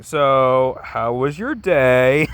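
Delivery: slow, drawn-out mock casual question; awkward small talk. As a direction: stretch the words to fill an awkward silence. Batman's awkward small talk with Joker.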